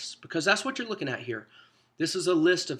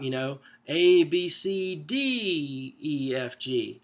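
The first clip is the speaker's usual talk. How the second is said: letters climbing up to D then sliding back down; mountaintop list; much slower than usual. Shown as a demonstration. Teacher showing Hebrew list shape, rising then falling tune. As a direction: sing the alphabet like a hill, peak at D. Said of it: "it would sound a little more like a mountaintop".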